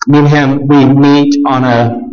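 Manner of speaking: ordinary talk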